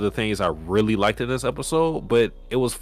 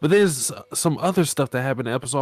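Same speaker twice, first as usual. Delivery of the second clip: usual speaking voice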